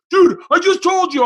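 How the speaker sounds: put-on high, loud exasperated kid; exasperated friend. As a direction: throw it back in disbelief.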